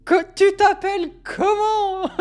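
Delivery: high, slow put-on voice, laughing through it; mocking high-pitched character voice; imitating a viral video. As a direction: replay the lockdown video gag in a squeaky voice. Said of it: "ça me rappelle pendant le confinement".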